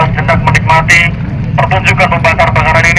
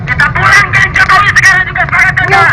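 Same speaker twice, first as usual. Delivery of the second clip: rousing orator, pitch climbing higher; rally speech; 8 semitones higher, 4 dB louder than usual. Acted. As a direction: whip up the crowd, building. Rally orator addressing crowd. (orasi demo yang membakar semangat)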